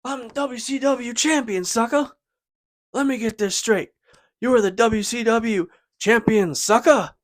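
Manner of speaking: high, loud cocky promo voice; Booker T impression. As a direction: sneer it like a cocky champion. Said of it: "Booker T's like".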